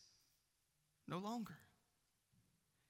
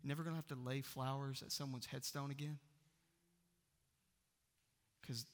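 ordinary delivery

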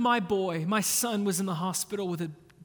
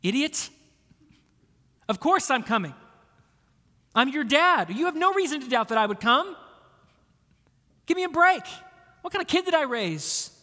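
higher and louder exasperated scolding; mock angry dad; 5 semitones higher, 4 dB louder, faster than usual. Father scolding his sick son in the hospital, angry. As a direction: bark at the kid like a fed-up father. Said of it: "what would I say to him".

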